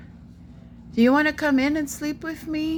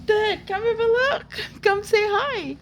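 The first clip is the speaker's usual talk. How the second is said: high, urgent call to Dad; excited pleading; 7 semitones higher than usual. Real, not acted. Calling Dad over to meet the bird, excited pleading. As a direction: beg him to come see.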